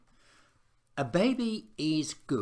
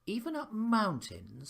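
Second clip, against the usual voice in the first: suspicious; 10 semitones higher than usual. A narrator's reading. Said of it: "said Emily suspiciously".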